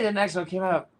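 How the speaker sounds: ordinary talk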